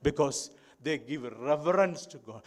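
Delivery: ordinary delivery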